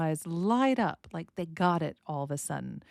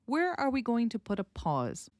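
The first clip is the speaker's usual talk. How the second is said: ordinary talk, nothing marked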